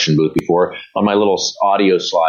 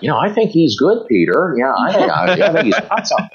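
lightly voiced old folks, higher pitch; a hint of an approving old couple, pitched up; imitated elderly couple; 8 semitones higher than usual. Old man and wife sizing up a newcomer, lightly imitated elderly voices. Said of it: "like, high eighties".

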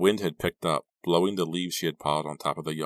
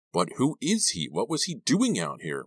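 high, insistent questioning; agitated higher-pitched demand; 8 semitones higher than usual. A narrator's reading. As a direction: press urgently for an answer. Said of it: "Ricky demanded".

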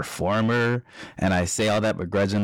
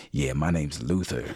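lower, cool put-on introduction; fake-name alias; 6 semitones lower than usual. Introducing yourself under a fake name with friends present, lower put-on voice. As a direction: drop your pitch and pose as someone else.

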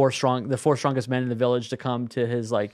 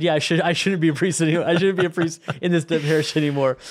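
brightly eager, a bit higher and louder; cheerful agreement; 4 semitones higher, 3 dB louder than usual. Retold or imitated. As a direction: agree happily, as if it's good news. Saint cheerfully agrees to own removal.

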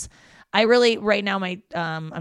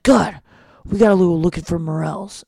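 louder parent voice, put-on; 8 dB louder than usual. Parents on a walk wanting to hunt morels, loud imitation. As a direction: mimic the parents' eager plan. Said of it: "they're like".

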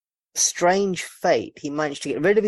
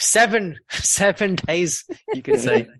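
slow, laughing seven days rising high at the end; milk the seven joke; laughing pun; 6 semitones higher, 3 dB louder, slower than usual. Real, not acted. Host punning on seven to co-hosts, laughing.

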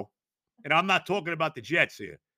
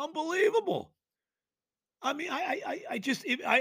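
usual speaking voice

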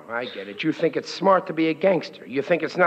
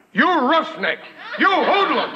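scolding shout; 11 semitones higher than usual. Acted. Father scolds son over bill.

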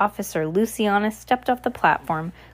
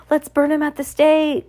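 higher-pitched call from the crowd; crowd member's cry; 6 semitones higher, 3 dB louder than usual. A narrator's reading. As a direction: call out eagerly to the room. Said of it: "cried a voice".

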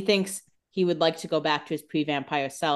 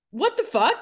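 much higher, incredulous what the fuck; a baffled squeak of outrage; mock disbelief; 11 semitones higher, 4 dB louder than usual. Stefan reacting to his mother's self-pity, high and stunned. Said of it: "He's like".

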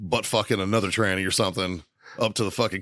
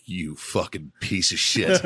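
stunned curse; 3 semitones lower, slower than usual. Retold or imitated. Friend finds planted picture.

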